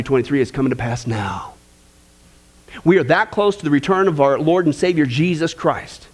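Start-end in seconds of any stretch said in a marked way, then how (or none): none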